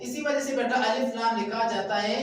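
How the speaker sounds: ordinary delivery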